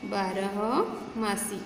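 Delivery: बारहमासी drawn out slowly, each syllable separate; slow model word. Shown as a demonstration. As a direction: say बारहमासी slowly for the pupils to repeat. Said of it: "teacher drills word slowly". (बारहमासी धीरे-धीरे, खींचकर)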